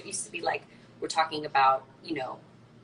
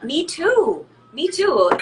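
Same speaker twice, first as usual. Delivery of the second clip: excited agreement; 8 semitones higher, 7 dB louder, much slower than usual. Real, not acted. Eager agreement.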